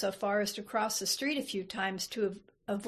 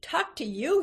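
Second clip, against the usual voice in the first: higher-pitched, incredulous comeback; belligerent retort; 6 semitones higher, 4 dB louder than usual. A narrator's reading. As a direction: throw it back as a challenge, rising at the end. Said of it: "He planted his feet belligerently".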